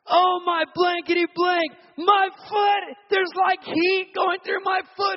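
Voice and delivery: very high, loud, astonished yell; high shouted "my foot"; amazed screaming. Retold or imitated. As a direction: shriek in disbelief at the healing.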